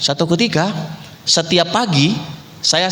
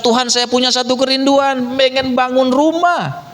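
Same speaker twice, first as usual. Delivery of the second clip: doa bergumul; 11 semitones higher than usual. Retold or imitated. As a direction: cry out a longing to God, stretched and high. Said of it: "bergumul di sana kepada Tuhan". (doa memohon dengan nada tinggi dan panjang)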